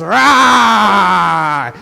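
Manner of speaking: loud, hammed-up wolf roar; scary wolf roar. Playing the wolf to scare the sheep, loud roar. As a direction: roar like the big bad wolf. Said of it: "Big, scary wolf".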